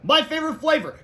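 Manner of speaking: high, loud teacher voice; teacher's announcement. A narrator's reading. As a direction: call it out to the class.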